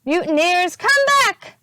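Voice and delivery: high, loud call after them; angry shout. A narrator's reading. Captain Bones shouting after his fleeing crew. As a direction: yell after the runaways. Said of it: "shouted Captain Bones".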